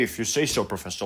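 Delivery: low, soft retold concession; quietly imitating grudging compliance; resigned acquiescence. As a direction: let it sink away, giving in. Retells giving in to the professor.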